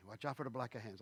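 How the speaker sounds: quiet, lightly put-on Italian accent; understated Italian accent. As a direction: warn like an old Italian grandfather, in a faint accent. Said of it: "doing the Italian thing".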